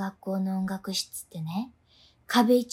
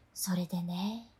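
whisper; 5 semitones lower, 9 dB quieter than usual. Acted. Whispered lead-in to ghost story.

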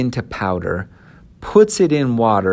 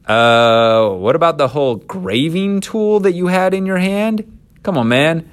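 incredulous challenge; 7 semitones higher, 4 dB louder than usual. Acted. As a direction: call out an obvious lie. Mock challenge to Aaron's excuse.

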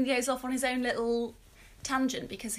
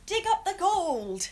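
playful urging; 8 semitones higher, 4 dB louder, slower than usual. Acted. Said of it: "you're skimming over it".

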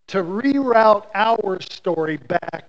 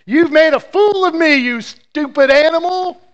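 much higher, louder furious yell at the donkey; angry shout; 10 semitones higher, 9 dB louder than usual. Acted. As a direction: roar it at the stupid animal. Balaam furious at his donkey.

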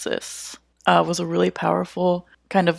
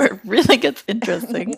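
laughing mimicry; 4 semitones higher, 6 dB louder, faster than usual. Repeats partner's phrase laughing.

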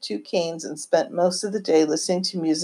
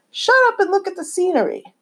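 much higher, mock-stern grandmother snapping at the kids; grandma's stern order; 13 semitones higher than usual. Grandmother hushing noisy kids in the car, mock-stern and high. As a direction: imitate a bossy grandma shushing kids. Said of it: "Her famous saying to keep us kids quiet was".

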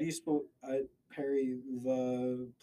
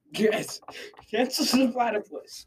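high, loud villainous agreement; eagerly rally behind the cause; gleeful cheering on; 8 semitones higher, 7 dB louder than usual. Acted. Villain cheers on cancelling the platypus.

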